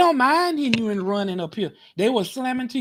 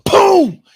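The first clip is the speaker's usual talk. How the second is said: a shouted boom, high and loud, like the car hitting the wall; mock crash boom; 11 semitones higher, 14 dB louder than usual. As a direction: bellow the impact sound as a crash effect.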